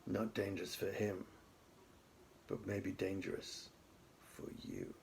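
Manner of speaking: put-on softly menacing boy; quiet threat. A narrator's reading. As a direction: let the warning sink in. Chinggis threat, fading low.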